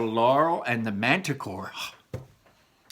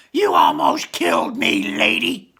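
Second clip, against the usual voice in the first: louder, lower, slower furious shout; angry sprite; 5 semitones lower, 10 dB louder than usual. Acted. Sprite scolding Laurel after hitting the windshield, angry shout. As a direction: yell at the driver who nearly flattened you.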